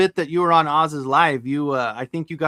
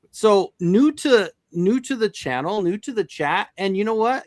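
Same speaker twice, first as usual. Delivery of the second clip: slow, higher welcome to new viewers; host welcome; 5 semitones higher than usual. Acted. Host greeting newcomers to the channel, drawn-out welcome. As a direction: stretch it out like a warm stream host.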